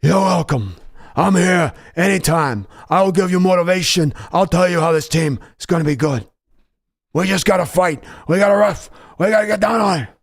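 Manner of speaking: hint of a fired-up Al Pacino pep talk; a chat-requested Pacino pep talk; lightly voiced Pacino. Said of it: "Excellent, Pacino, my guy".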